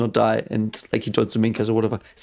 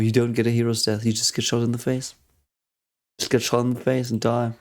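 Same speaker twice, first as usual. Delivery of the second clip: ordinary talk, nothing marked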